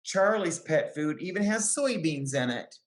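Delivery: usual speaking voice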